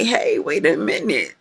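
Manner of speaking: mock shell-shocked protest, high-pitched. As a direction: recoil from people, lightly hammed up. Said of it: "feel a little shell-shocked and say".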